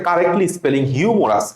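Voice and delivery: ordinary delivery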